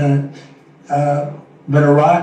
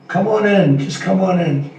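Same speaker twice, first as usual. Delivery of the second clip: higher-pitched mock invitation; mock welcome; 4 semitones higher than usual. Politician mimicking an open-border welcome, mock inviting. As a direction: lightly imitate waving everyone in.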